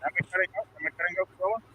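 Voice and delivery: distant off-mic voice; talking far from the mic; from across the room. Real, not acted. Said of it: "now you're sounding li-, like across the room from your mic".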